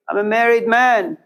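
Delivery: lightly voiced proud man; voicing Russell. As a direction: state it like an identity. Russell answers who he is.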